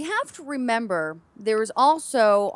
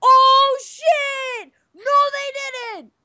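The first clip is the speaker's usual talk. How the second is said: high, loud, overjoyed shriek; excited shout; 14 semitones higher, 7 dB louder, much slower than usual. Retold or imitated. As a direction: scream with stunned delight. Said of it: "this was my reaction".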